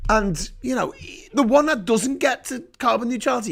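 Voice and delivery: ordinary delivery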